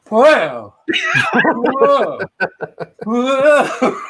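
high, laughing startled whoa. Retold or imitated. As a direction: yelp like a scared driver. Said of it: "I would just be like this".

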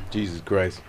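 coughing after water went down the wrong way; sputtering and coughing mid-sentence; choking on water. Real, not acted. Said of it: "He got me choking on my water".

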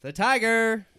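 high, loud, slow drawn-out ringmaster call; showman's announcement. Acted. The magician calls his act on stage in a loud, slow showman's voice. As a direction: build up the crowd like a ringmaster.

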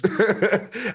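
a high, bright burst of real laughter. Real, not acted. Laughs at own brag.

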